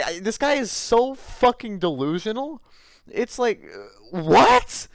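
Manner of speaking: very high-pitched, squeaky incredulity; appalled disbelief. Real, not acted. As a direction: voice shoots way up in horrified bafflement. Appalled reaction to the neighbor story.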